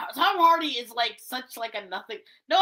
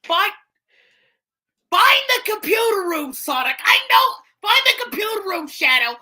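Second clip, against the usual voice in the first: higher, louder, grating yell; Gilbert Gottfried impression; 6 semitones higher, 5 dB louder than usual. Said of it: "Gilbert Gottfried".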